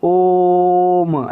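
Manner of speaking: ओम chanted very slowly, vowel sustained; long held om. Shown as a demonstration. Teacher demonstrating the extra-long vowel with a chanted om. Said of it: "बहुत देर तक आवाज़ करते हैं".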